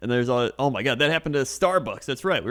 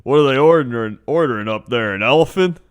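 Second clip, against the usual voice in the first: mock loud complaint; 7 dB louder than usual. Guy complaining in line.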